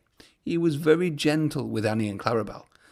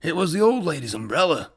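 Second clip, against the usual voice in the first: out of breath. A narrator's reading. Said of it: "catching his breath".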